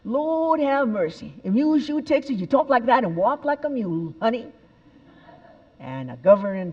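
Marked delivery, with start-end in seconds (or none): 0.0-4.5 higher, slow Texan drawl; Texas drawl. Retold or imitated. Speaker mocking Texan English for audience, hammed-up Texas drawl. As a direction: ham up a Southern belle. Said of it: "what do we do with the English language in Texas".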